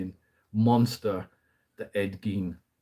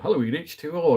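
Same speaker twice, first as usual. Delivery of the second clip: higher, lightly mocking quote of a co-host; imitando o colega; 7 semitones higher, 4 dB louder than usual. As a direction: quote your co-host calling out the title. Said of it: "and Stu went".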